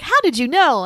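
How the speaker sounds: mock amazed Dorothy. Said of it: "she's like".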